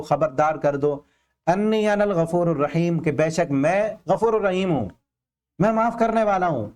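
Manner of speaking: ordinary talk, nothing marked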